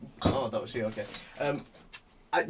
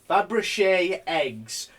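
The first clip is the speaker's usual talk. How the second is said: slow, savoured Fabergé eggs; drawn-out showy "Fabergé eggs"; with gusto; much slower than usual. Shown as a demonstration. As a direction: roll "Fabergé eggs" out slowly with relish. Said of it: "Say it with some fucking gusto".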